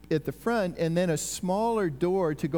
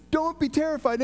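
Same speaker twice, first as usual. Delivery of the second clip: much higher-pitched acted voice; angel's urgent reassurance; 10 semitones higher than usual. Angel to the women in the tomb, urgent reassurance. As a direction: voice the messenger calling out to startled visitors, high.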